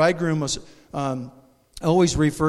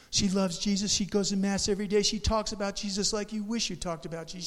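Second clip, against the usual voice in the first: normal talking voice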